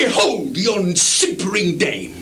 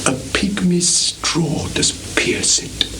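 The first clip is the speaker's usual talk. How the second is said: breathy hushed whisper; 2 semitones lower than usual. Acted. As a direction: let it fall to a secret. Lear drops to a hush.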